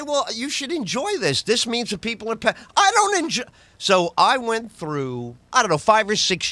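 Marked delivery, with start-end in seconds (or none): 0.0-3.4 mock exchange. Quoted exchange, high.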